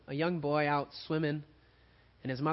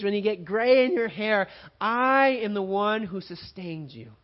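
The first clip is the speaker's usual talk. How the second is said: preaching God's voice; 5 semitones higher, 6 dB louder than usual. Acted. Pastor voicing God to the congregation, loud and preaching. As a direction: speak as God promising to carry them.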